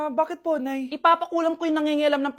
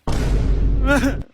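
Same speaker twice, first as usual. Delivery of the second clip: mocking laugh; 4 semitones lower, 5 dB louder than usual. Laugh after next year.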